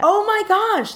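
high-pitched real astonishment; thrilled, disbelieving exclamation; amazed. Real, not acted. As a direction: let the voice leap up in surprise. Amazed reaction to the coincidence.

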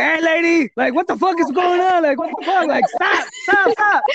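high, loud panicked yelling at mom; retold yelling. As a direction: yell it high and desperate, begging her to stop. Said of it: "I was like".

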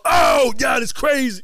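high, loud, hyped-up holler; Will Smith impression. Retelling two impressionists going back and forth, high excited shout. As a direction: shout it in a comic star impression. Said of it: "We were just both going back and forth".

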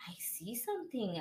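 unvoiced whisper, mom squinting at the sky. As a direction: breathe it like you've spotted something secret. Mom spotting something in sky.

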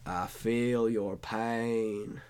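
very slow, low, soft drawn-out voice; president impression. Posing a president for co-host to guess, slow and low. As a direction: stretch each word softly, like a sympathetic president. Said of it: "Let's do president impersonations".

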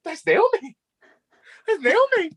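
high, excited shriek of recognition; excited squeal. Retold or imitated. Fan recognizing the actress on screen, excited high squeal. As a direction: squeal the name like a starstruck fan. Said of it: "and then I was like".

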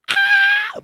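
very high piercing scream; imitated scream. Imitating her cousin screaming in her sleep, a piercing high scream. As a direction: shriek like someone screaming asleep.

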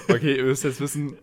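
usual speaking voice

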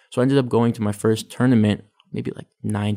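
normal talking voice

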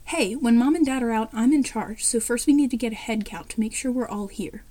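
usual speaking voice

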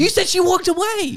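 high, loud squawk of objection; incredulous protest. Real, not acted. Listener catching the storyteller out, incredulous outburst. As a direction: call out the contradiction at a high pitch.

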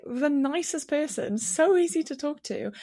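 ordinary talk, nothing marked

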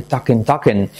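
"talking" as "TAK-en", like tacos; repeated falling "tak-en" for "talking". Shown as a demonstration. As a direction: say "talking" with an E in the ending. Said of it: "A mí me suena mejor".

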